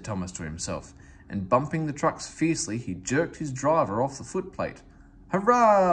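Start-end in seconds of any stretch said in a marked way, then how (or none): 5.3-5.9 high, loud gleeful hurrah; laughing cheer. A narrator's reading. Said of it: "laughed Thomas".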